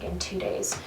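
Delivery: normal talking voice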